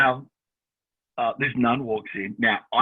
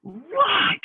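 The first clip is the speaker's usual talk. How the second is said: a sharp, high, louder Right; defiant retort; 5 dB louder than usual. Retold or imitated. Young man snapping at his mum mid-argument, defiant. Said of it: "And I went".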